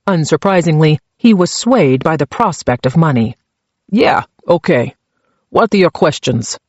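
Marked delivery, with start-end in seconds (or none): 3.9-6.6 put-on greedy Czech-accented prisoner agreeing; thick Czech accent. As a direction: give in with a heavy Czech accent. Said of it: "his accent so thick".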